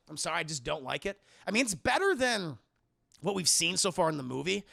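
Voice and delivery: normal talking voice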